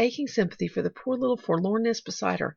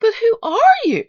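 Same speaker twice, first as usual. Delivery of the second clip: put-on high, loud little-girl cry; baffled question; 12 dB louder than usual. A narrator's reading. Pollyanna questioning the stranger who met her, bewildered. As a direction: demand to know, bewildered. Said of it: "questioned Pollyanna".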